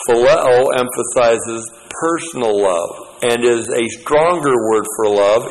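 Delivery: ordinary talk, nothing marked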